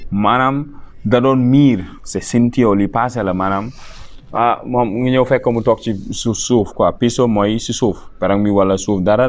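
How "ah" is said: ordinary talk, nothing marked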